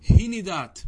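هندات said "hinidāt", i after the n; kasra on the nun. Shown as a demonstration. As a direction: say هندات as hi-ni-dāt. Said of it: "بالكسر". (هندات بكسر النون)

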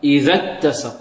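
"إذا اتسق" joined, dhal straight into doubled t; linked without hamza. Shown as a demonstration. Teacher models the linking, "إذا اتسق" as idhat-tasaq. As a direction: run "إذا اتسق" together, dropping alif and hamza. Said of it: "نطقا ثم التاء".

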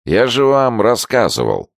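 put-on high, slurring drunk whine; tongue tripping over words; slurred drunk speech. A narrator's reading. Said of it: "Заплетающимся языком".

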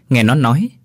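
plain reading voice

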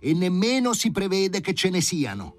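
a character voice set well above the narrator. Acted. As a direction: sound sorry but firm. Character answers the professor.

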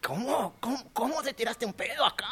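high, accusing wife's voice; mock angry wife. Husband retells his wife accusing him, high mock anger. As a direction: imitate the cross wife demanding an answer. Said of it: "mi mujer se queda muy enojada y dice".

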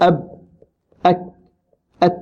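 أب، أك، أت each cut off on its final stop; sound held shut. Shown as a demonstration. Teacher drilling shiddah letters, each sound blocked short. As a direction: separate syllables, voice trapped at the end of أب، أك، أت. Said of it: "حبس فيها الصوت كله".

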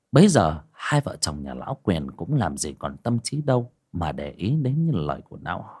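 plain reading voice

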